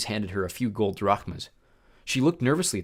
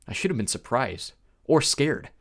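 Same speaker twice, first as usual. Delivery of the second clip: usual speaking voice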